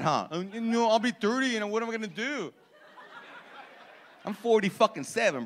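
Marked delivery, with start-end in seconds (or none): 0.3-2.5 put-on whiny twenty-one-year-old. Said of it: "Twenty-one-year-olds think that".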